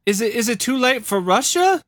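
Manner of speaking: high, questioning plea; Christine. Acted. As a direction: ask haltingly, voice climbing to the end. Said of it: "a recorded exchange between Christine and Jim Jones".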